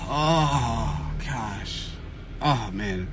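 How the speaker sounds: long unvoiced sigh, then a soft, sinking oh gosh; deflated sighs. Real, not acted. Host sighing over the lost auction bid, deflated. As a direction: let the disappointment drain out quietly. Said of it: "just upsets me".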